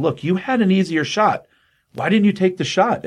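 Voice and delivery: acted-out exasperated questioning; challenging reproach. Acting out Simon's challenge to her.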